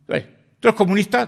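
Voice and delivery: high, loud accusing question; lieutenant grilling the recruit about politics; officer's interrogation. Retold or imitated. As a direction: fire the question at him like a suspicious officer.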